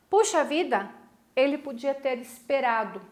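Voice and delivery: ordinary talk, nothing marked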